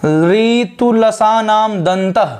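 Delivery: ऋतुलसानाम दंतः drawn out very slowly, falling at the end; very slow recitation. Shown as a demonstration. Teacher slowly reciting the fourth sutra for the class. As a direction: chant the sutra syllable by syllable. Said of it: "और इसका नाम क्या है?".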